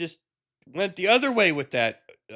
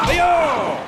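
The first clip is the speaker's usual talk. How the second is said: high, louder far-off whoop; mock hey-yo call; 9 semitones higher, 6 dB louder than usual. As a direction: whoop it like a sitcom crowd cheer. Meme shout after quote.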